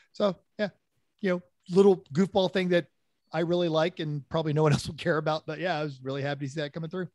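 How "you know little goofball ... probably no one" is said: ordinary delivery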